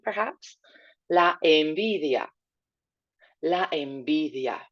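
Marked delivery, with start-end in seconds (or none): 1.1-4.6 envidia with the V flat, almost like a B, slow; soft Spanish V. Shown as a demonstration. Teacher models envidia for class, V like B. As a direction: say envidia softening V toward B. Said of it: "make it a nice flat sound ... like the letter B".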